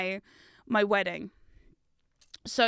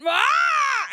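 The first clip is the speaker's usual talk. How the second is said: put-on sudden high yell of a thwarted kid; loud high toddler shriek; child's angry shout; 7 dB louder than usual. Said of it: "And I got".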